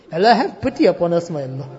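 ordinary talk

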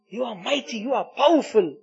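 ordinary talk, nothing marked

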